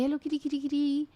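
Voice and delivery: calling the cat. Retold or imitated. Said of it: "So I say".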